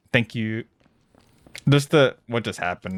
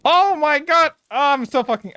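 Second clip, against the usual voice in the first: high loud exclamation; shocked; 4 dB louder than usual. Real, not acted. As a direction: squeal it in shock. Shocked outburst.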